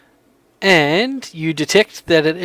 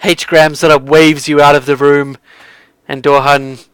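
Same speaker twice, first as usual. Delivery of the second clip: ordinary talk, nothing marked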